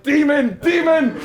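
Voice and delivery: high, loud mock-terrified yell; hammed-up cry of alarm; crying out in terror. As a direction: scream it out as if facing a demon. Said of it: "and I cry out".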